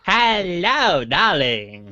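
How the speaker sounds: high, camp hello darling; flamboyant greeting. Retold or imitated. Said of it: "exactly like that".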